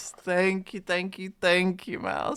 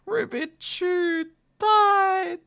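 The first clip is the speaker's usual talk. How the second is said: mock wail; 12 semitones higher, 3 dB louder than usual. Mourning a fallen player.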